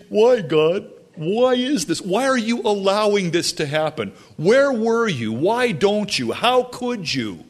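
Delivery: high, complaining cries of why; mock whining to God. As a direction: whine at God like a hurt child. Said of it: "they're crying to the Lord".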